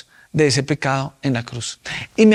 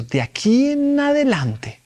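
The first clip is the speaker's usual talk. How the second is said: "De aquí en adelante" said high and slow, drawn out; solemn voice of God; 8 semitones higher, slower than usual. Retold or imitated. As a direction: lift "De aquí en adelante" up high and stretch it like a divine decree. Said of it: "Ok, tienes que hacer un compromiso".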